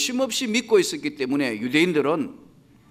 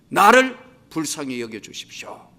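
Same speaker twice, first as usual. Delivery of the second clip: normal talking voice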